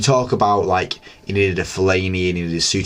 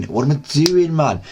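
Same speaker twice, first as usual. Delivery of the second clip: exasperated mock cry; 7 semitones higher, slower than usual. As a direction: throw the question up in mock despair. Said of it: "you just look at yourself and go".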